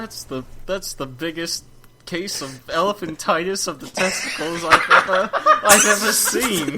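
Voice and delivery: cartoon Australian marvelling, close to cracking up; attempted Australian accent. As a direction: stretch the ending in a laughing Australian accent. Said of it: "I can try one".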